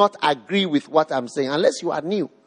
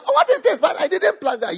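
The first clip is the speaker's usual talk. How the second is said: laughing, higher mock bewilderment; mock surprise; 6 semitones higher than usual. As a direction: play the startled farmer with a laugh. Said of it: "You say".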